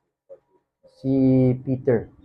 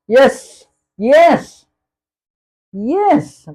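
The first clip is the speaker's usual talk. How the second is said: higher, eager yes, yes, yes, each one climbing; eager repeated yes; 7 semitones higher, 3 dB louder, much slower than usual. Acted. Preacher voicing Peter, eager and rising. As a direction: Peter rushing to assure Jesus he loves him.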